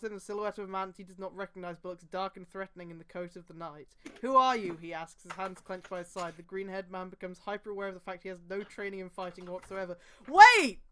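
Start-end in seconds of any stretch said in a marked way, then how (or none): 4.2-4.8 loud challenge. Acted. Reading a character's challenge. 10.3-10.8 sharp, high cry of Wait; loud shout. Real, not acted. Sudden objection to the story.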